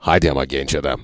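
low dubbing voice, mild reproach; dubbed-film reproach. Shown as a demonstration. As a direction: chide a buddy like a deep-voiced dubbed movie character. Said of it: "siyahi bir, e, arkadaşın diğer arkadaşa". (pes dublaj sesiyle ufak serzeniş)

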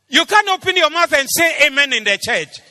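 loud, high-pitched rebuke from the pulpit; full-force preaching. Real, not acted. Preacher rebuking a silent church, full-force preaching. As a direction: shout the rebuke at the congregation.

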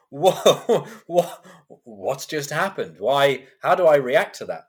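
taken aback. Retold or imitated. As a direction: relive the bewildered reaction, half laughing. Retelling shocked inner thoughts.

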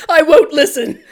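loud, laughing mock anguish; anguished father. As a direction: wail it in hammy despair. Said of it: "Raul Julia's like".

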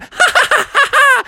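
very high, gleeful cackle; mischievous laugh. Acted. Froggy plotting to be naughty, high mischievous laugh. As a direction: cackle high at your own naughty scheme.

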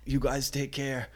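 lightly mock the dad, as if dying of the flu; dramatic dying dad. Imitating dad's melodramatic goodbye when he gets the flu, hammed up. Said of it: "he's like".

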